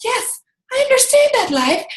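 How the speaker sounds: high gushing relief from a viewer; high mock gushing voice. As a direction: squeal it like a delighted fan. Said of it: "they're gonna say".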